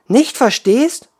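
high, loud, slow indignant echo. Acted. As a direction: throw the words back in disbelief. Water woman indignant echo.

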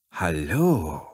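breathy purring seductress; seductive voice. A narrator's reading. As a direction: purr a sultry hello. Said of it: "mit verführerischer Stimme".

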